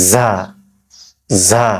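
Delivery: "ঝা" as a long buzzed za; buzzing z sound. Shown as a demonstration. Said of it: "নিচের দাঁতের গোড়ার সঙ্গে লাগিয়ে". (জিভের আগা নিচের দাঁতে লাগিয়ে "ঝা", z ধ্বনিতে)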